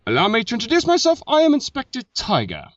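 high-pitched, hammed-up British inspector introducing himself; fake English accent. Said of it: "let me give this another shot".

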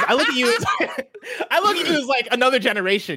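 high, laughing disbelief. Real, not acted. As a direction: laugh through it, half shocked. Laughing at cohost's age.

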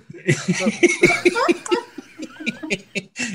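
a burst of squealing laughter; high shrieking laugh. Real, not acted. Reacting to the host's joke.

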